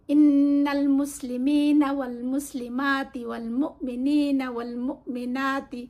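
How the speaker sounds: slow, chanted Quranic verse; Quran recitation. Shown as a demonstration. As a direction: recite the ayah slowly like tilawat. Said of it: "একটা আয়া আছে". (ধীরে কুরআনের আয়াত তিলাওয়াত)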